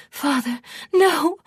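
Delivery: high-pitched, desperate cry; frightened daughter begging; terrified plea. A narrator's reading. Daughter pleads as treason is named.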